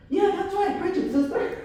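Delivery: high, amused imitation of a cheering onlooker; egging her on. Guy cheering on a sister's preaching, imitated and amused.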